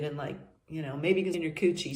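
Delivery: normal talking voice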